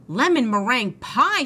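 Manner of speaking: loud, high cry of delight; loud, excited outburst; delighted exclamation. A narrator's reading. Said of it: "she exclaimed".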